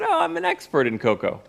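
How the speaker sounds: high, sing-song fake confidence; mock boast. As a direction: ham up the bluff of an instant expert. Ironic claim of expertise.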